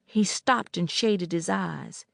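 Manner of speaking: ordinary delivery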